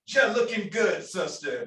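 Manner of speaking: playful mock Jamaican greeting; Jamaican accent. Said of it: "which is my terrible accent, Jamaican accent".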